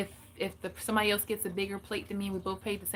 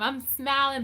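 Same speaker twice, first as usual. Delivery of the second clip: ordinary talk, nothing marked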